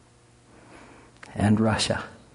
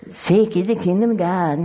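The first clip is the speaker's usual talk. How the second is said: high put-on voice of the devil quoting scripture; mocking devil voice; 9 semitones higher than usual. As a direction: play the devil, high and sly.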